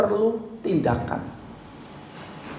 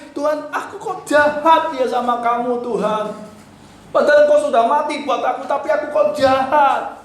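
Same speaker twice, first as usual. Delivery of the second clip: higher, pleading cry of guilt; weeping prayer; 6 semitones higher than usual. Shown as a demonstration. Preacher modeling a crying prayer to God, high and pleading. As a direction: beg God's pardon, voice lifted.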